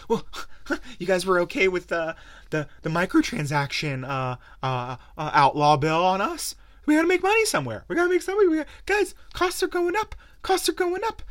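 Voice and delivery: very high, loud squeaky mock whine; mocking publishers. Publishers whining excuses to outraged gamers, very high squeaky mock voice. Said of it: "sit there and say".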